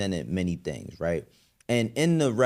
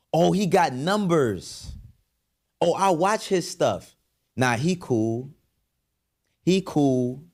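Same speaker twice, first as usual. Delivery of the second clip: higher, hammed-up impressed fan voice; mock impressed fans; 6 semitones higher, slower than usual. Mimicking fans drawn to attention, mock impressed. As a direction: imitate easily impressed followers, pitched up.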